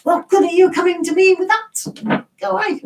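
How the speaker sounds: high-pitched comic protest; mock shouting. Acting out what English people say, mock shouting. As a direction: send someone packing in a high mock yell. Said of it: "It's like, we're shouting".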